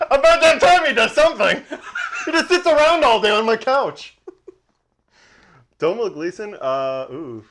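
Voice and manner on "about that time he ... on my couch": loud, high, laughing outburst; mock scolding shout. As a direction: holler a teasing complaint about a loafer. Shouted teasing after co-host's remark.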